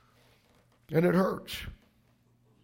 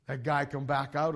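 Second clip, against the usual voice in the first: usual speaking voice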